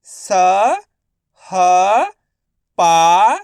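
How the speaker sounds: स, ह, पा each held alone; letter-by-letter sounding. Shown as a demonstration. Teacher for pupils to repeat, स, ह, पा sounded separately. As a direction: stretch स, ह and पा with pauses. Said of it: "आपको मेरे साथ साथ रिपीट करना है".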